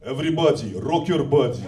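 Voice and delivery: put-on a light Russian accent on a boy band line. Shown as a demonstration. Showing boy band lyrics in a mild Russian accent. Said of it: "anything you do with a Russian accent".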